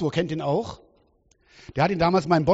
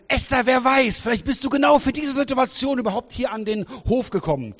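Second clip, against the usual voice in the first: Mordechai urging; 8 semitones higher, 4 dB louder than usual. Retold or imitated. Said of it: "der Mordechai sagte".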